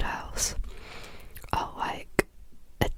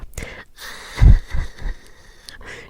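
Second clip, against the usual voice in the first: whispered laugh; 10 dB louder than usual. Real, not acted. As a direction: chuckle under the breath. Laughs mid-story.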